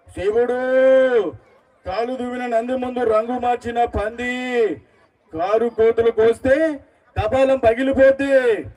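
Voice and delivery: slow, drawn-out film-hero declamation; Balakrishna. Acted. Mimic delivering Akhanda movie dialogue to the audience, grand slow declamation. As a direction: roll out each phrase slowly like a sacred war chant. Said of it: "అఖండ మోహన్ నుంచి dialogue చెప్తారండి".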